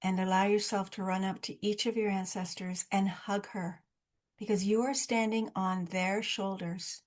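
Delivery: soft, unhurried meditation voice, eyes-closed calm; guided meditation. Acted. Group leader guiding Zoom participants through an ancestor visualization, soft meditative voice. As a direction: ease listeners gently through the journey.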